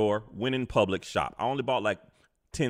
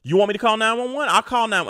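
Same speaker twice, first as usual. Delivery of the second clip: higher, louder heated challenge; heated threat; 10 semitones higher, 6 dB louder, faster than usual. Retold or imitated. Narrator daring the older lady, heated and loud. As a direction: dare her to let you call.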